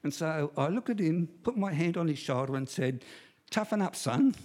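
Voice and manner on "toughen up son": higher, mock-stern fatherly order; mock-tough father. Father to his crying son, mock-tough. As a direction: play the unsympathetic dad, lightly.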